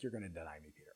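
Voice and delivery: quiet certainty, gently correcting Peter; Jesus speaking. Retold or imitated. Jesus quietly telling boastful Peter, low and soft. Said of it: "Jesus is like".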